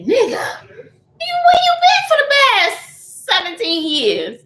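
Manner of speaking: high, loud shouted demand; imagined outburst. Pretend. To the absent ex about seventeen missing years, a shouted demand. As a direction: yell it at the ex in disbelief. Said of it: "What I want to say".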